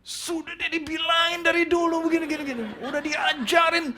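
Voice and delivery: high-pitched dad's outburst, hammed up; acted angry scolding. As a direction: shout it like an exasperated father letting loose. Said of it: "saya tergoda untuk saya marah".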